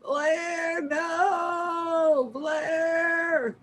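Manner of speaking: high, drawn-out cry without extra volume; anguished calling out. Acted. Sailor in the ocean calling for the lost captain, anguished cry. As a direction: wail the name across open water.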